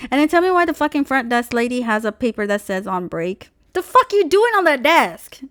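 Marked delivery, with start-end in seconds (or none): 3.7-5.4 indignant outburst. Real, not acted. Indignant outburst at front desk.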